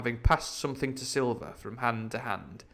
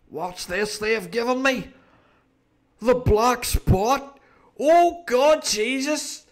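much higher, louder terrified shout; panicked pirate; 13 semitones higher, 8 dB louder, slower than usual. Acted. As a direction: scream in panic at the death sign.

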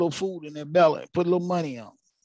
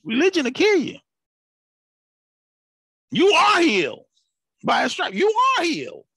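preaching at full force; 11 semitones higher than usual. Acted. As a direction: preach it out, climbing and pressing hard.

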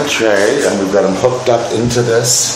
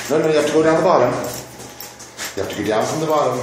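higher, fast coaxing to a dog; 5 semitones higher, 6 dB quieter, much faster than usual. Real, not acted. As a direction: urge him along quickly. Coaxing dog onto wash tray.

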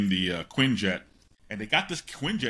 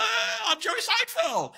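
Jerry Seinfeld. Retold or imitated. Telling how nerves hit meeting a star, comically high squeal. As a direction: voice shoots up into a high, whacked-out squeal. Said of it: "my voice goes up two octaves".

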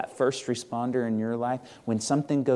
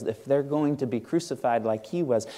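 ordinary talk, nothing marked

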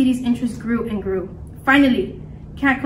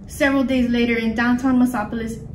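ordinary delivery, nothing marked

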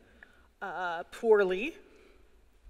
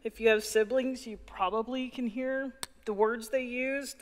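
ordinary delivery

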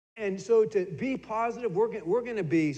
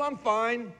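higher quick "I'm fine"; breezy deflection; 5 semitones higher than usual. Retold or imitated. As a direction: shut the question down lightly. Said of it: "How are you?".

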